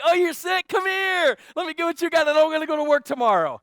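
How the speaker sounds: high, loud over-the-top delight; hammed-up gleeful shouting; mock eager welcome. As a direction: shout it like an absurd invitation. Absurd eager welcome of sick person.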